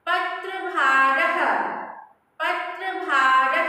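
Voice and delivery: पत्रभारः drawn out slowly, final ह sounded; slow model word. Shown as a demonstration. Teacher drills पत्रभारः slowly for students to echo. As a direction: stretch पत्रभारः for repetition. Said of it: "you pronounce with me".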